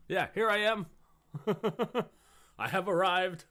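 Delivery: high, loud grand entrance; excited. Real, not acted. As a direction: announce yourself triumphantly. Hyped streamer entrance.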